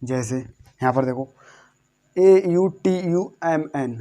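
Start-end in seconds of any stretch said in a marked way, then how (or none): 2.2-4.0 slow letter-by-letter spelling. Shown as a demonstration. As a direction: give each letter its own beat. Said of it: "अब यहाँ पर इसको क्या पढ़ेंगे".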